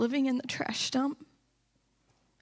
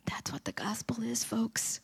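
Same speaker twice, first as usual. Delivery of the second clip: whispered emphasis; 7 dB quieter than usual. Real, not acted. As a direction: drop to a whisper for the key line. Sudden whispered point to congregation.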